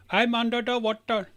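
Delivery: comic put-on cry for help; funny-video imitation. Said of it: "That's a funny video".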